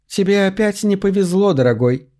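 high, falsely sighing voice dropping at the end; false sympathy. A narrator's reading. Cassandra taunts trapped Max, false sympathy. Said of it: "фальшиво вздохнула она".

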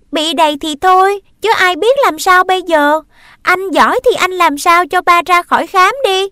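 high and loud; angry retort. Acted. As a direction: shout the challenge back. Sister answers in quarrel.

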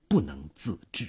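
ordinary delivery, nothing marked

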